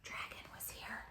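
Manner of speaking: sneaky whispered signature; hushed mischievous whisper. Acted. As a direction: whisper it like the dragon's secret mark. Whispered sign tag.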